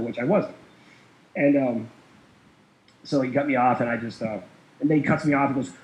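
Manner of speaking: ordinary talk, nothing marked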